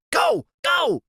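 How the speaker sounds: high urgent go go; urgent cry. A narrator's reading. Sidekick yelling his friend into a run. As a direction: yell it high to get him moving. Freak urging escape.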